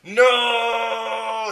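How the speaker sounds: hammed-up desperate shout. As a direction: scream it out. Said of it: "someone shouted".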